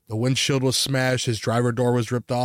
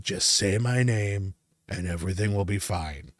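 lightly voiced ghost; 3 semitones lower, 3 dB quieter than usual. Ghost approaching the paralysed sleeper.

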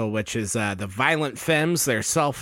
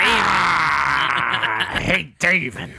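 slow drawn-out mock hate; mock angry; much slower than usual. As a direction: groan it out slowly. Mock hatred.

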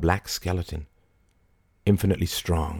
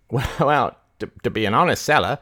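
rustic keeper; 6 semitones higher, 3 dB louder than usual. A narrator's reading. Barnstable, a rustic keeper, bargaining.

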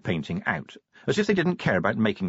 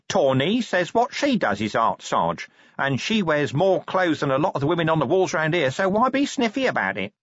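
higher corporal voice, mildly indignant; 7 semitones higher than usual. Acted. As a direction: argue back at the sergeant. Corporal defends his friend's art.

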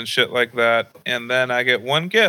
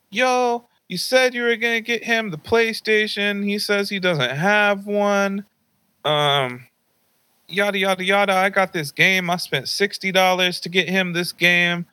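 higher, mock-indignant grandma; grandma scolding; 8 semitones higher than usual. Grandma yells at dad about missing PlayStation, higher mock-indignant. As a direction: pitch up and confront him like an outraged grandma.

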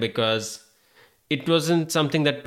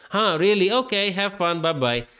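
mock dismissive; 8 semitones higher than usual. Stranger brushes him off.

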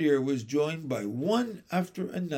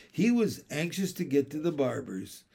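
usual speaking voice

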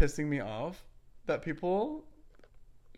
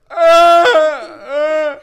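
loud high wailing sob, hammed up; imitating her own loud sobbing; mock loud ugly crying wail; 12 semitones higher, 21 dB louder than usual. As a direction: wail like an ugly cry, playing it up. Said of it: "loud ugly crying".

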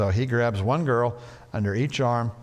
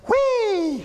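high gleeful squeal; excited whee; 4 dB louder than usual. Shown as a demonstration. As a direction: sing out whee like a kid. Playful ball pit demo.

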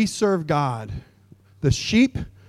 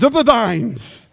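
slow hammed-up old-English; mock King James; 6 semitones higher, 4 dB louder, much slower than usual. Mocking King James prayer language, slow and hammed-up. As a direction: drag it out pompously. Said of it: "Or the thines".

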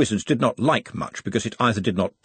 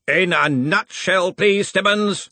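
growled; 10 semitones higher, 3 dB louder, slower than usual. A narrator's reading. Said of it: "growled Ridcully".

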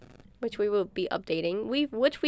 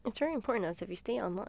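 fast, softer automated phone line; 10 dB quieter, much faster than usual. Pretend. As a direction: rattle it off like a recording. Imitating automated phone message.

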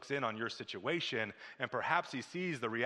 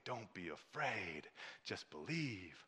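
gentle voice of Jesus; 5 semitones lower, 11 dB quieter than usual. Pretend. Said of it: "you'll hear Jesus' voice saying".

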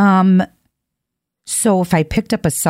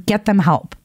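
ordinary talk

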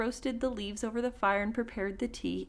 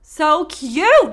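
much louder and higher, so cute; excited gush; 6 semitones higher, 14 dB louder than usual. Real, not acted. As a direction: gush over the sweet ending. Reacting to sweet story ending.

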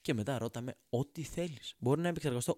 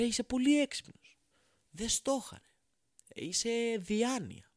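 sarcastic awe. Acted. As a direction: fake being dazzled by a genius. Sarcastic mock amazement.

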